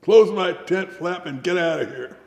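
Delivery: high, loud irritated order; retold hollering. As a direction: holler it at an intruder. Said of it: "So I started hollering".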